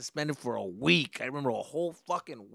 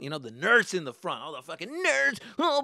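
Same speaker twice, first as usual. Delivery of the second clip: ordinary talk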